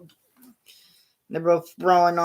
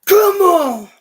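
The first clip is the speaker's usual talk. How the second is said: much higher, much louder cry; wrestler yell; 12 semitones higher, 12 dB louder than usual. Retold or imitated. Wrestler shouting at his opponent, big yell. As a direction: bellow it across the ring. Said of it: "He goes".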